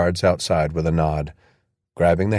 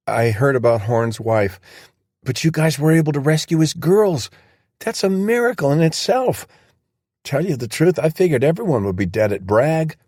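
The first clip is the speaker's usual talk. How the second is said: put-on young soldier voice; 8 semitones higher than usual. Riley's voice, raised pitch.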